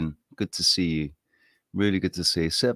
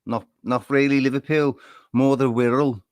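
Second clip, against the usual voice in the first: Wirral accent; 6 semitones higher, 5 dB louder than usual. Shown as a demonstration. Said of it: "ends up being Liverpool".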